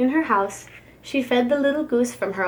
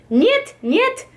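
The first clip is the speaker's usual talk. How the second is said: shrill high yelled "Niet, niet!"; scream; 12 semitones higher, 4 dB louder than usual. A narrator's reading. Babushka screams at the goose over spilled paint. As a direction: shriek it out, high and loud. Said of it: "Babushka screamed".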